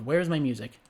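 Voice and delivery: usual speaking voice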